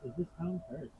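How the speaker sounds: soft, tense whisper; hushed whisper. Real, not acted. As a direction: breathe the question, uneasy. Hushed question to spirits.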